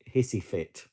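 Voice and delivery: normal talking voice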